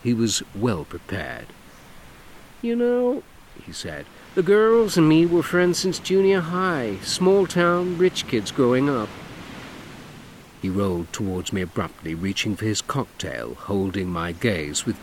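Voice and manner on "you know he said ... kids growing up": camp it up, lilting and showy; flamboyant man. Acted. Dougie voiced in story.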